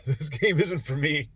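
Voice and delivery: retold line broken up by a laugh; amused retelling with a laugh; laughing while quoting. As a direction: quote it through your own amusement. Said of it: "I'm laughing now".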